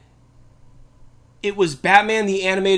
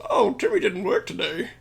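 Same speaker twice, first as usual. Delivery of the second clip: gleeful Bane; 11 semitones higher than usual. Retold or imitated. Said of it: "and it's just".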